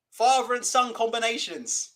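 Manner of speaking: normal talking voice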